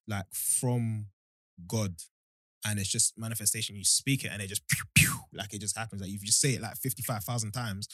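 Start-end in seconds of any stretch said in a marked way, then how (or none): 4.7-5.1 quick, whistly "pew pew" zap; imitated zap. Shown as a demonstration. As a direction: make a little shooting noise. Mimics things appearing by magic.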